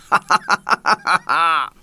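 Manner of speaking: rapid, even volley of ha-ha-has; hearty royal laugh. A narrator's reading. King laughing at his own joke, hearty laugh. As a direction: laugh heartily with a drawn-out final ha. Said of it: "ils firent tous sept chorus au rire royal".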